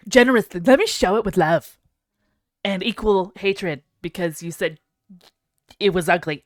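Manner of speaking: loud, hammed-up showy presenter; lightly voiced mocking friend; mock Pyro. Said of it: "He's like".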